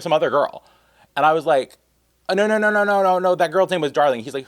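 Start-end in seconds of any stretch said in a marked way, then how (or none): 2.3-4.2 rapid higher, louder no no no; flustered denial. Retold or imitated. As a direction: scramble to fix the mix-up. Frantic correction to the driver.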